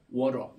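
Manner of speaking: 워러 with the T flapped to an R; American flap T demo; American water. Shown as a demonstration. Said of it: "그냥 워러 이렇게".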